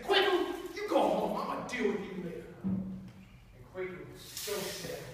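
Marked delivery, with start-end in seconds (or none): 0.0-2.5 high, loud order to go home; a father sending his son away; stern, raised voice. Acted. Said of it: "he said".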